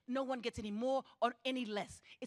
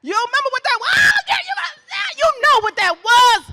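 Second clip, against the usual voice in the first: much higher and louder cry to the crowd; hyped shout; 22 dB louder than usual. Real, not acted. Speaker to crowd, remembering youth, loud excited shout. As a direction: rouse the room with a shout.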